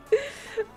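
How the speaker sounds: a high, squealing burst of laughter. Real, not acted. Laughing at the kids calling their mother an Eshe, high squeal.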